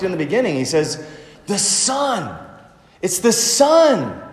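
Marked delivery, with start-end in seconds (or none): 1.5-4.2 "Son" pitched high, then falling hard; preacher driving home the word "Son"; emphatic stress on Son. Shown as a demonstration. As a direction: hit "Son" high and drawn out. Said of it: "the emphasis on the Son is emphatic".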